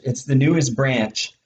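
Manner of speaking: usual speaking voice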